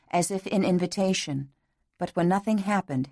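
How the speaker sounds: ordinary delivery, nothing marked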